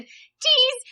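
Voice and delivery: high-pitched photo-pose cheese; saying cheese. Retold or imitated. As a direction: re-enact a perky say-cheese for the camera. Posing for the licence photo.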